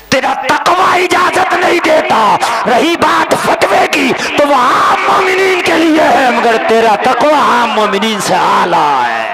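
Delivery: roaring sermon climax; full-force preaching. Real, not acted. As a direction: cry it out to the whole crowd.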